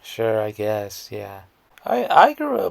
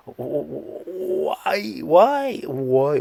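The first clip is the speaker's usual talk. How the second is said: incredulous why; 5 semitones higher, 4 dB louder, much slower than usual. Real, not acted. Incredulous outburst at crying.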